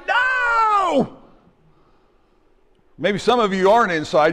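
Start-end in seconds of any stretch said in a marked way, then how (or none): 0.1-1.2 high shouted NO; inner shout. Retold or imitated. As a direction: cry out a desperate no. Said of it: "inside you're like".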